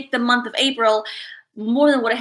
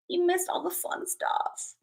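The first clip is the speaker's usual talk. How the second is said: usual speaking voice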